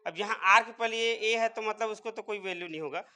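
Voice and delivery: normal talking voice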